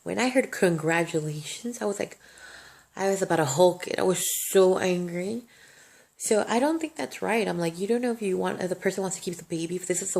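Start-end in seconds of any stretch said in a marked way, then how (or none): 3.0-5.4 loud and heated, building anger; furious outburst. Real, not acted. Telling her viewers how angry the doctors made her, heated and loud. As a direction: let the anger burst out loud. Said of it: "And when I say angry".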